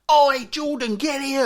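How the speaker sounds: loud, high shout across the shop; yelling parent. Retold or imitated. Mum calling her runaway son Jordan, loud shout. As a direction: bellow at the kid.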